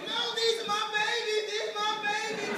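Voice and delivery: high, pleading mother; high mom voice. Acted. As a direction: cry out like a protective mom. Mom protecting kids in story.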